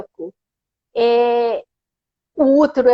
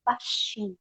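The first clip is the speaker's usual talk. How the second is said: demonstrated hush, far softer; speaking very quietly; 3 semitones lower, 13 dB quieter than usual. Retold or imitated. Describing how people talk inside the sanctuary, hushed. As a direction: drop right down to a tiny, quiet voice. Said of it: "fala baixinho".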